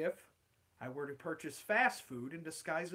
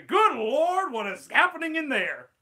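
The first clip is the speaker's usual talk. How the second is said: higher, louder alarm; alarmed Chalmers; 9 semitones higher, 11 dB louder than usual. Acted. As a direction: shout at the fire in the kitchen. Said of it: "Steamed Ham script".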